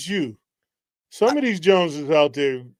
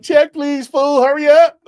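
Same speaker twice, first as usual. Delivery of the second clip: high, loud call for the bill; urgent mock demand; 8 semitones higher, 7 dB louder, slower than usual. Diner desperate to escape a bad conversation, loud mock urgency. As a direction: call out to hurry the waiter, hamming it up. Acts out urgently wanting to leave.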